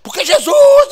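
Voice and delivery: very high, loud preaching cry; pastor preaching. Retold or imitated. Pastor preaching full of God to church, impassioned shout. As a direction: imitate a fired-up little pastor's cry. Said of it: "e pregava assim".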